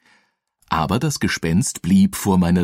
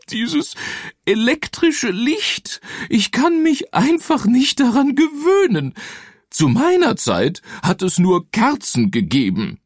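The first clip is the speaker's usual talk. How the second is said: high, sighing ghost voice; weeping ghost. Acted. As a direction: moan it like a homesick ghost. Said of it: "von neuem zu seufzen".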